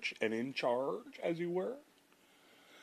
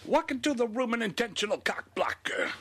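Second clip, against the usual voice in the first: singing the lyric in mock Cockney; Cockney accent; 11 semitones higher than usual. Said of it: "some Cockney accent in there".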